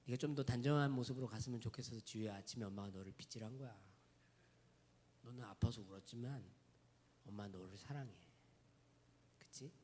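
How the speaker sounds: put-on soft, gentle voice to a little child; softly as a mother to her daughter; tender mother. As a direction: soothe a child after brushing her hair.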